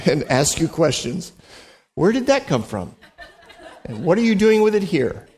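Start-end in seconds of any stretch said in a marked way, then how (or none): 1.9-5.3 laughing mock interrogation. Mock-questioning a tambourine player.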